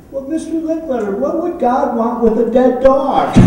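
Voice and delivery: put-on high kid voice, already cracking up; little boy asks the punchline, breaking into laughter. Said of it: "the little boy turns to Art and said".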